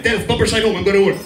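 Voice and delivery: imitating dad. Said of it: "he's like".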